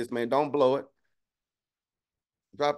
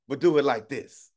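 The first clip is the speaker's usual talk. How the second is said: bargaining with God; 4 semitones higher, 5 dB louder than usual. Retold or imitated. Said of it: "some of us will try to finesse God and be like".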